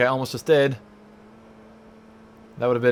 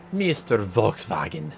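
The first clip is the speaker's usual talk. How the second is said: mock German; slower than usual. Repeats name in odd voice.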